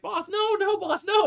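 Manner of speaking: extremely high mock squeal; squeaky pretend terror; panicked grot. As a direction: beg frantically in a tiny creature voice. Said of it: "He's like".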